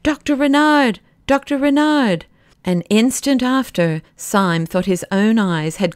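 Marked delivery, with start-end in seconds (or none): 0.0-2.2 high call across a distance; hailing shout, not full force. A narrator's reading. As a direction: hail someone far off, pitch raised. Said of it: "calling out".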